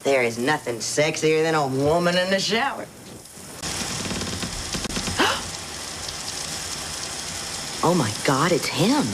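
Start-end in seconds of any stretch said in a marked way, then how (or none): 3.6-7.8 long high breathy gasp. Acted. Woman in the shower, gasping long and high.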